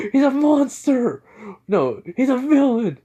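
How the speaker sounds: high, loud mock scream; screaming in panic. Thugs running away from the hero, screaming. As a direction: cry it out like you're fleeing a monster. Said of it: "screaming, saying".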